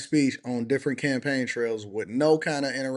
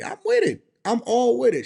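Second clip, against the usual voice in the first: excited agreement; 9 semitones higher, 4 dB louder than usual. Real, not acted. Enthusiastic agreement.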